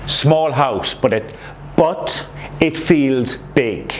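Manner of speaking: slow, weighty "but it feels big"; stress on but. Shown as a demonstration. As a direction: lean hard into "but it feels big", drawn out. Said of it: "You want to emphasize it feels big".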